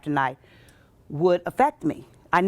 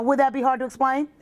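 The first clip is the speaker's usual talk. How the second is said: usual speaking voice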